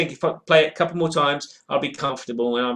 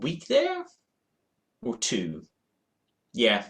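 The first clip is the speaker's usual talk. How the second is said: ordinary talk, nothing marked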